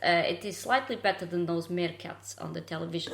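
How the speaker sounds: generic Eastern European comic voice; playful, exaggerated Eastern European English; Eastern European accent. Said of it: "doing the generic Eastern European".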